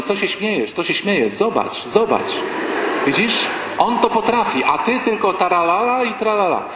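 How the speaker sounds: jab at him over the neighbours' car; mock reproach to the husband; nagging wife. Wife scolds husband about neighbours.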